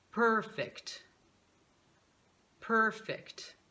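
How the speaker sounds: put-on "purpose, perfect, word, girl, her" each twice with a full, rounded er; stressed American er. Shown as a demonstration. Teacher drilling stressed syllables for repetition, strong rounded American er. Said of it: "Primeiro, com a sílaba acentuada".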